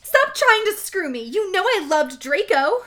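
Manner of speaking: high, loud indignant shout; hammed-up angry yelling. A narrator's reading. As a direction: shout it in melodramatic outrage. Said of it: "I shouted".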